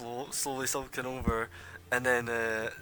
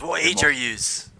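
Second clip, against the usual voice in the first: gruff local; 4 semitones higher, 11 dB louder than usual. Acted. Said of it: "He says".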